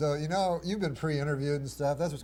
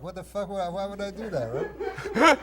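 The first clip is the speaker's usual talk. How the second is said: mock disbelief; 6 semitones higher, 4 dB louder than usual. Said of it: "I like".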